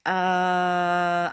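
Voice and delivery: hesitating "uh". Shown as a demonstration. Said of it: "sound we use in English to hesitate".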